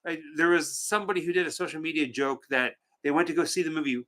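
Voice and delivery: ordinary delivery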